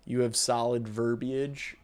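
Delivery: ordinary delivery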